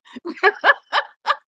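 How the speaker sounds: high, giddy burst of laughter. Real, not acted. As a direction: let a delighted laugh spill out. Laughs after saying she gets excited.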